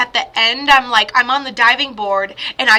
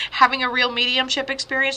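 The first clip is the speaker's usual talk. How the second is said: usual speaking voice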